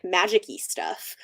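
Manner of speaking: usual speaking voice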